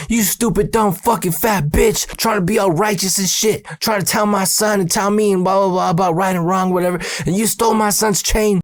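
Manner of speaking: high sing-song mock tirade; mom ranting. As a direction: rip into the clerk like an angry mom. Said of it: "talking all kinds of shit to this lady".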